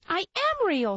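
a small toy standing up for itself; insistent. A narrator's reading. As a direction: insist on being real. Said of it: "said the little rabbit".

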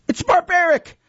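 a pleading apprentice; frightened boy. A narrator's reading. Oik protests.

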